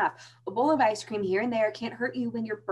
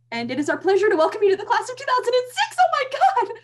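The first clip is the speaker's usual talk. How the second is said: excited reading; 12 semitones higher, 7 dB louder than usual. Acted. Friend reading the acceptance letter aloud, rising to a thrilled shout. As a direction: voice rising high and loud with joy.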